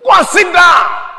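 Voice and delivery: loud, high shout, slow on go and sit down; shouted rebuke. Acted. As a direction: bark the order at the seated king. Preacher acting king's rebuke.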